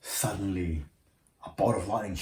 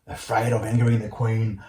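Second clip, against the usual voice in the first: ordinary delivery, nothing marked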